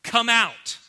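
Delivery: loud, high, blunt order; commanding shout. Acted. Jesus orders Lazarus out American-style, commanding shout. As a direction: call him straight out. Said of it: "Jesus is using the American version here".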